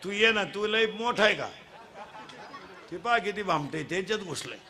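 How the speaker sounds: ordinary delivery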